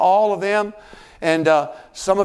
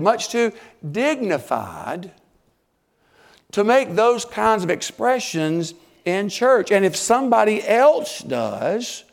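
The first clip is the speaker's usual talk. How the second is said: ordinary talk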